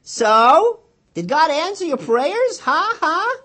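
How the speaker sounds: loud, high gloating taunt. Acted. Brother taunting his sister at bedtime, loud and high. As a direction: jeer at her triumphantly. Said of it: "comes into the room taunting her".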